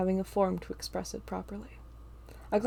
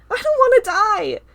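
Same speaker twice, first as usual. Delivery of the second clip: terrified cry; 14 dB louder than usual. A narrator's reading. Carl terrified.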